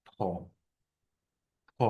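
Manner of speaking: ফ said as an aspirated p with a puff of air; ফ with a strong burst of breath; correct p sound. Shown as a demonstration. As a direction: push air out through the lips on ফ. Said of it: "এটার সঠিক উচ্চারণ হচ্ছে".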